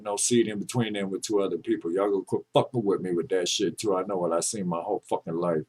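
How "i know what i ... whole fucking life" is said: ordinary talk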